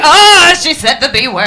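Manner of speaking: loud mock-scandalised gasp, then dropping back; touchy audience. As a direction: imitate a crowd shocked by a taboo word. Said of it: "how touchy the audiences up here are".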